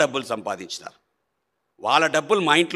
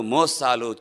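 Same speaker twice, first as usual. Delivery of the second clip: ordinary talk, nothing marked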